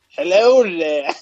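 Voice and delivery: slow, unfinished stab at an ogre accent; Shrek. Shown as a demonstration. Friend takes a turn in the accent contest, attempting Shrek. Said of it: "Let me think about Shrek".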